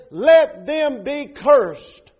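each word slow, heavy and loud; solemn curse. Acted. Pastor quoting Paul's curse on false preachers, slow and heavy. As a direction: pronounce the curse like a judgment.

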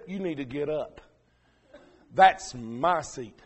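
a hint of an offended old lady; stern lady. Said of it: "a lady said".

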